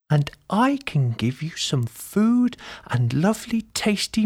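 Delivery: high, admiring granny voice; delighted old lady. A narrator's reading. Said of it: "the old lady replied".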